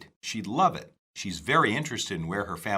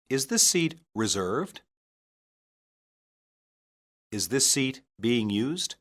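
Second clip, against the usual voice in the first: slow, clearly spaced lesson read with pauses; drill reading; 2 semitones higher, 3 dB louder, slower than usual. Shown as a demonstration. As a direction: read each variant for learners to repeat. Teacher reads substitution drill.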